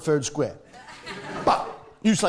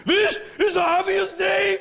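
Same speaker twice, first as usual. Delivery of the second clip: put-on tormented Welsh accent; 13 semitones higher than usual. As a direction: wail wedding vows in an agonised Welsh accent. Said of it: "he sounds as though he's dying of some inner fucking hurt and torment".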